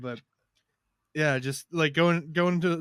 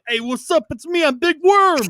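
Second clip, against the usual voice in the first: high, loud goofy greeting, much higher than usual; imitating Big Worm; 13 semitones higher, 10 dB louder than usual. Telling friends about a stinky schoolmate, high loud goofy greeting. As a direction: belt it out like a big dumb friendly guy. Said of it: "and just say".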